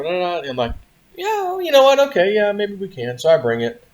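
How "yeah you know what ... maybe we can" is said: mock eager agreement. Giving in to wife's plan.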